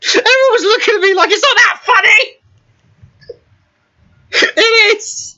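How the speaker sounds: very high, squealing through laughter; helpless laughter. Real, not acted. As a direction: gasp it out in hysterics, nearly crying. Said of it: "why I was the only one laughing".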